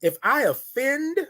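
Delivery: "offend" drawn out on a high, rising tone; slow, lifted "If I offend"; emphatic speaking style. Shown as a demonstration. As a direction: hold "offend" up high, unfinished. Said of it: "you gotta talk like that".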